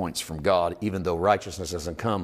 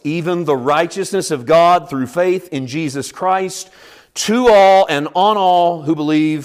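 loud, raised, emphatic Bible reading; proclaiming scripture; 6 semitones higher, 7 dB louder than usual. Real, not acted. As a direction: declare the verse to the whole congregation. Proclaims scripture at full force.

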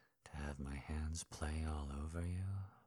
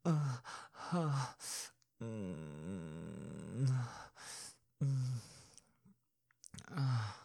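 moaning; 6 semitones higher, 4 dB louder, much slower than usual. Acted. Afterglow moans.

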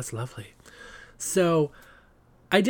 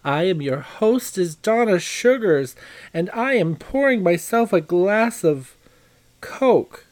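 high, loud glamorous hostess voice; campy drag queen; 6 semitones higher, 6 dB louder, slower than usual. Acted. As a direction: purr it like a diva hostess. Drag hostess opening the show.

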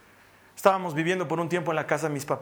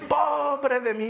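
drawn-out, higher self-pitying wail; mock lament; 7 semitones higher, much slower than usual. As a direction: stretch it out, feeling sorry for yourself. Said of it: "nos gusta lamentarnos".

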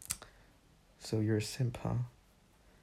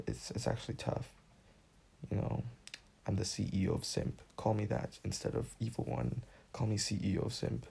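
normal talking voice

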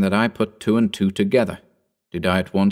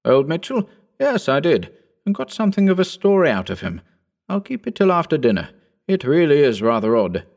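put-on a lighter, higher young gentleman's voice; young Phillipson; 6 semitones higher than usual. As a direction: lift the voice into the eager young squire.

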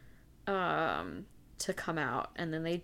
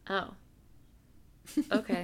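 underwhelmed reaction; 5 dB quieter than usual. Retold or imitated. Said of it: "Instead of being like".

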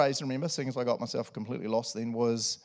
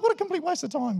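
exasperated disbelief. Retold or imitated. The pastor's unspoken thought about the elder, high and incredulous. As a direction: squeal it in mock outrage. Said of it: "And I was like".

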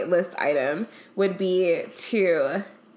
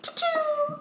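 high squeaky choo-choo; silly train noise; 3 dB quieter than usual. Real, not acted. Playing with her friend, a high silly noise. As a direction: make a silly train call. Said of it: "What the fuck was that?".

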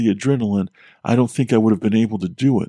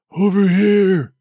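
muffled voice; 8 semitones higher, 4 dB louder, slower than usual. Acted. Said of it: "came the muffled voice again".

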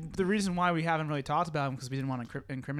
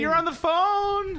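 loud excited announcement; 11 semitones higher, 6 dB louder, slower than usual. Real, not acted.

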